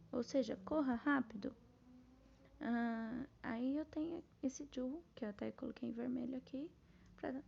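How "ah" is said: ordinary delivery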